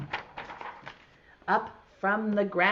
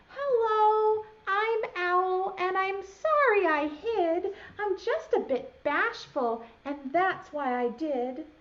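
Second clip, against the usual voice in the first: Owl's voice; 9 semitones higher than usual. Acted. Owl introduces itself.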